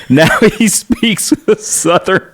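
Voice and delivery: slow, high, laughing through the words. Real, not acted. Recalling the relatives' surprise at his accent, laughing high. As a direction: crack up while saying it.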